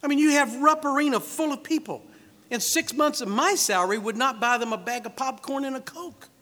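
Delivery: high, exasperated reasoning; acted disbelief, stretching out the last phrase; incredulous complaint. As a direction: argue how hopelessly short the money falls. Philip's protest continues, in modern terms.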